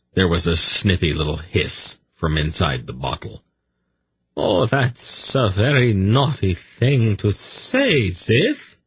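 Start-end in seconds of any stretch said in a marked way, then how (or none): 4.4-8.8 put-on high, slow baby-talk scolding; gentle reproof. A narrator's reading. Zadi to his hissing snake, gently reproving. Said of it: "Zadi gently reproved her".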